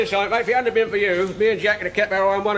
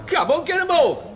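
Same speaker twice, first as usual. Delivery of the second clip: jeering strip heckle; 8 semitones higher than usual. Acted. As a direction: egg him on to strip.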